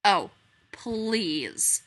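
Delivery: scornful "oh, please"; sneering. A narrator's reading. Strange animal sneering at Pooh's faith in Christopher Robin. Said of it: "sneered the strange animal".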